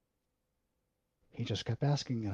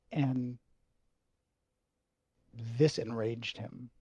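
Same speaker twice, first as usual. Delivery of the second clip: ordinary talk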